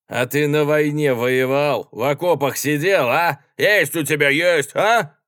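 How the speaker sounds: loud, high-pitched hostile challenge; angry attack. A narrator's reading. Spiteful soldier suddenly goes for the passenger, angrily. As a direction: round on him with pointed questions. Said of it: "Вдруг напустился на него злобный солдат".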